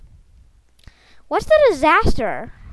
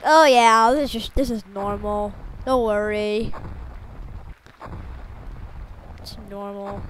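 unbothered schoolkids; 5 semitones lower than usual. Acted. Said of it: "People at the school are like".

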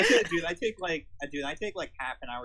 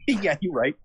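ordinary talk

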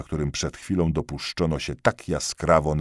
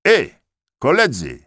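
loud high call to the comrades; 10 semitones higher, 8 dB louder than usual. A narrator's reading. Tyrolean soldier warning his comrades, calling out loud. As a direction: call across the room, louder and higher. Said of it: "zawołał Tyrolczyk".

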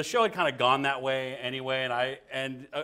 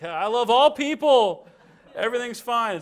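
high, slow, loud wholesome voice; overly sweet; 11 semitones higher, 9 dB louder than usual. Retold or imitated. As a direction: sound innocent and harmless. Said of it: "I'm like".

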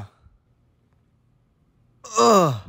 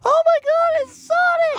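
put-on high girly voice. Amy voice, squealing high.

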